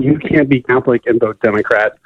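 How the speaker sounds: ordinary talk, nothing marked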